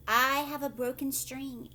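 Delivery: ordinary delivery, nothing marked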